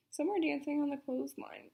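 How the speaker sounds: ordinary delivery, nothing marked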